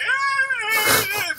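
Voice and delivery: mock cry. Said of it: "And you cry".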